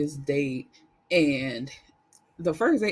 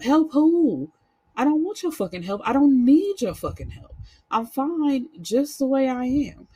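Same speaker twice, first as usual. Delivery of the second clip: raised, heated voice pushing back; angry retort; 8 semitones higher, 4 dB louder than usual. Retold or imitated. Daughter shouting back at her critical mom, angry. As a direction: fire it back at the nagging mother.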